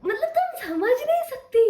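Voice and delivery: high, loud and drawn out, bursting with glee; gushing excitement. Real, not acted. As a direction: squeal it slowly, thrilled to bits. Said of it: "इतनी ज़्यादा excited हूँ मैं".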